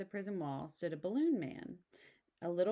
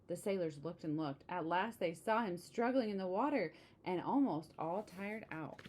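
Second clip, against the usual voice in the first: ordinary delivery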